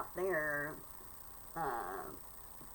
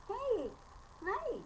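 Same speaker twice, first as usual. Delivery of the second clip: talking to a pet; 14 semitones higher than usual. Real, not acted. Greeting the cat.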